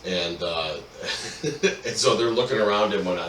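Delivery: ordinary talk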